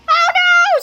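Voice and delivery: high, loud cartoon shriek; terrified scream. A narrator's reading. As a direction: scream in comic horror. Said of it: "screamed Fluffy".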